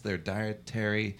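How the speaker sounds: ordinary talk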